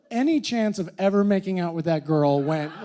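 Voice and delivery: ordinary delivery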